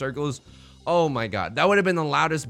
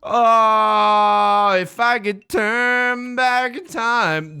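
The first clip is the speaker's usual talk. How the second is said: much higher, louder, slow mock-anguished cry; hammed-up wail; 8 semitones higher, 7 dB louder, much slower than usual. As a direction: wail it like a tragic hero. Opponent wins big hand.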